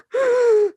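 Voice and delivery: sharp high shocked gasp. Retold or imitated. Mom gasping at the insult to Dawn. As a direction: gasp as if the insult just landed.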